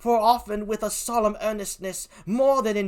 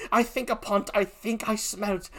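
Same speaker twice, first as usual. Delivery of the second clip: usual speaking voice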